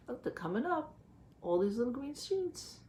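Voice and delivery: usual speaking voice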